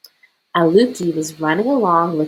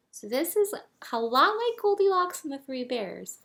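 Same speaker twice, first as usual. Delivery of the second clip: put-on higher, softer child-directed voice; talking to children; 9 semitones higher, 9 dB quieter than usual. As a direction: gently introduce a bedtime story. Introduces story to children.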